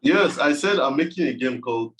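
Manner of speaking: normal talking voice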